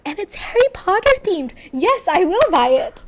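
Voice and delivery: high, loud, giddy mock agreement; mock eager yes. S1 replaying her own eager yes at the checkout. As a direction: jump at the Harry Potter bag.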